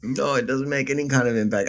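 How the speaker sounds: ordinary delivery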